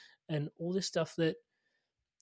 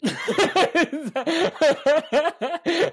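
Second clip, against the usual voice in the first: bursts out laughing high and loud; big real laugh at the joke; loud laughter; 13 semitones higher, 13 dB louder than usual. Real, not acted.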